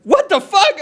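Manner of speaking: high, loud retold disbelief; mock incredulous outburst. Man retelling his reply to cop's accusation, mock incredulous. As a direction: burst out, pitch way up, imitating your shock.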